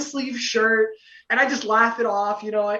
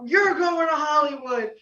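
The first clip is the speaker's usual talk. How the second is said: high, drawn-out triumphant call; celebratory announcement; 7 semitones higher than usual. Retold or imitated. Judges to winning contestant, celebratory high call. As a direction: announce the good news high and slow.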